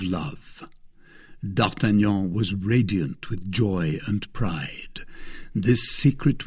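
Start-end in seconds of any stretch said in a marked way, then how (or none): none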